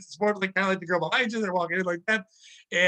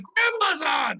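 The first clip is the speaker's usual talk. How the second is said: put-on high-pitched childish holler; kid calling out; 8 semitones higher, much slower than usual. Kid calling a relative to the phone, high holler. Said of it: "like you're a kid".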